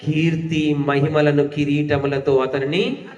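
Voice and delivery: loud, slow, measured Bible verse reading; solemn public reading of a psalm; scripture reading. Acted. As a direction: read the verse out strongly, stretching the line endings. Reads a scripture verse aloud.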